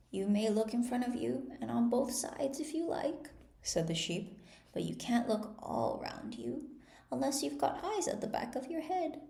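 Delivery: put-on a fussy old sheep peering over spectacles; old sheep shopkeeper. A narrator's reading. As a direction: sheep shopkeeper lecturing Alice, lightly voiced.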